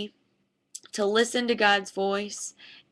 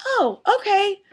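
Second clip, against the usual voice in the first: higher, meek little okay from the student; meek student reply; 10 semitones higher, 3 dB louder than usual. Retold or imitated. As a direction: give in sheepishly, pitched up. Student meekly giving in.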